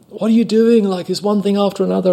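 high-pitched exasperated telling-off; mock scolding. Angry customer scolding clumsy waiter, mock exasperation.

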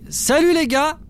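high bright hail to the guards; cheery greeting. Acted. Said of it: "et je dis". (salut joyeux et sonore)

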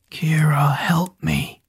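weak breathy whisper; fading, whispered cry for help; faint voice. A narrator's reading. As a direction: barely get the words out. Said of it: "Zack's voice was faint".

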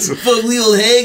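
high, loud teasing surfer dude voice; mock her Valley surfer drawl, slowly; California surfer voice. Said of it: "your weird California surfer voice".

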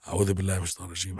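a rushed recitation; very fast recitation. Shown as a demonstration. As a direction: fire it off in a breath. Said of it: "recites it".

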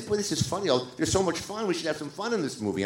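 retold delight. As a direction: imitate someone tickled by an idea. Retold delighted colleague's reaction.

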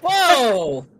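loud, high amazed gasp of a word; impressed whoa. Real, not acted. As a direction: react to the blast with real awe. Watching explosion on screen.

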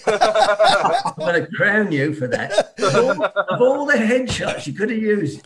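laughing through mock threat; threatening through real laughter. Real, not acted. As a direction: laugh it out, slowly at first. Laughing threat about pink hat.

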